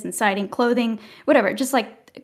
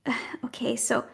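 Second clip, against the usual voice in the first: sighing hesitation; 8 dB quieter than usual. Acted. Elf sighs before confession.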